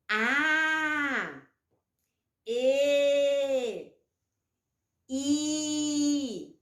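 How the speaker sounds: each vowel held long and clear; drawn-out vowels. Shown as a demonstration. Teacher modelling vowels slowly for children. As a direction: stretch every vowel sound for the kids. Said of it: "Vamos fazer?".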